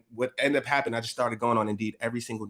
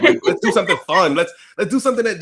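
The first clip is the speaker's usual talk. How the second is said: louder and higher, keen; eager; 7 semitones higher, 9 dB louder than usual. Retold or imitated. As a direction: pitch a fun idea eagerly. Quoting himself and sales partner.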